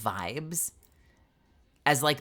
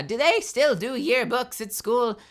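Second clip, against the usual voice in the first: slow, lightly voiced old-timer asking; old person; 6 semitones higher, 3 dB louder, slower than usual. Shown as a demonstration. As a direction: ask it like a puzzled grandparent. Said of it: "I sound like an old person now".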